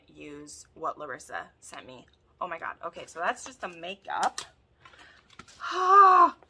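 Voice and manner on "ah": loud, high squeal; real shriek of joy; delighted scream. Real, not acted. Opening pretty makeup, delighted scream.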